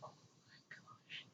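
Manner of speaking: hushed gasp of delight; breathless whisper. Retold or imitated. Fan whispering to viewers about the couple, breathless and awed. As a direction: whisper it in awe. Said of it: "I was like".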